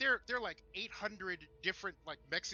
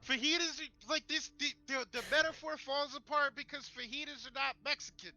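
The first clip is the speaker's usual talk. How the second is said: louder, higher, worked-up objection; heated rant; 8 semitones higher, 4 dB louder than usual. Real, not acted. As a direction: blurt it out, indignant and fired up. Continuing fajita rant.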